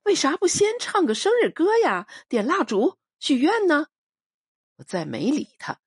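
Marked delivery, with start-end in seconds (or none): 0.0-3.9 high-pitched, giggly coaxing; playful call to the whole table, much higher; cheerful teasing. A narrator's reading. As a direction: chirp it up high like a merry prankster. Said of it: "朱秀秀笑嘻嘻地捧出蛋糕".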